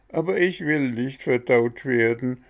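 a mild howl of refusal. Acted. Bengele refusing to be digested, mild howling whine. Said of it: "heulte Bengele".

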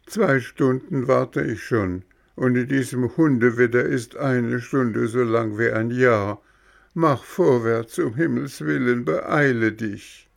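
high, eager puppet voice; a small puppet insisting on who he is; puppet boy voice. Acted. Bengele drängt ungeduldig. (hohe, eifrige Hampelmannstimme)

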